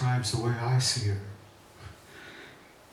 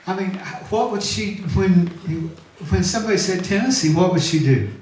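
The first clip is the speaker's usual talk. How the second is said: excited; 7 semitones higher, 6 dB louder than usual. Real, not acted. Prompting crowd, rising excitement.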